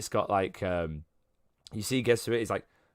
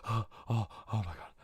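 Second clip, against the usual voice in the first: soft, gasping amazement; hushed excitement; 3 semitones lower, 10 dB quieter, much faster than usual. Acted. Man comes to after seeing magic, hushed gasping excitement. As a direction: gush under the breath, overwhelmed. Character revives overwhelmed by magic.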